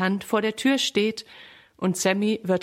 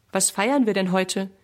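ordinary delivery, nothing marked

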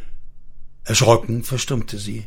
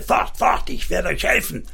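angry threat; 6 semitones higher than usual. A narrator's reading. Father chasing children away in a fit of rage, angry threat. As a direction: chase them off angrily without full volume. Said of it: "schrie er in einem Wutanfall".

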